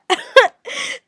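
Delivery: high, loud laugh breaking out; burst of laughter. Real, not acted. Vlogger cracking up at Shiv's line, high laugh.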